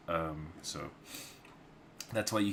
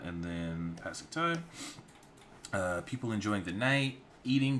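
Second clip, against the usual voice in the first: usual speaking voice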